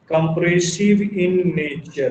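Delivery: "Compressive in nature होगा" said very slowly, word by word; slow answer for notes; slow dictation. Shown as a demonstration. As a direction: drag it out so they can write.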